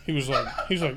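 ordinary talk, nothing marked

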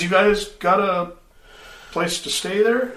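high, folksy country voice; farmer voice. Acted. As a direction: play the friendly rural local. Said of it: "he says".

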